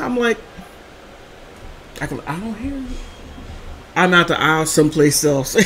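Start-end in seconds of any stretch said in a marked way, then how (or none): none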